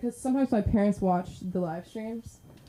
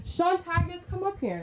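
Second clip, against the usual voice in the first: high, softer singsong summons; calling up comic; 10 semitones higher, 4 dB quieter than usual. Real, not acted. Host to next comic, high-pitched call-up. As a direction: pipe the name out high.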